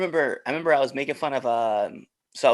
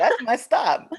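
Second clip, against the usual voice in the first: laughing call; 14 semitones higher, 5 dB louder, slower than usual. Pretend. Spotting Taco Bell while riding.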